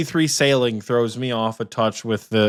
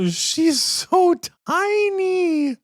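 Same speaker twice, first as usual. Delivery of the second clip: high, very slow cooing over something tiny; much slower than usual. Real, not acted. Co-host coos over the tiny cat in a high, slow voice.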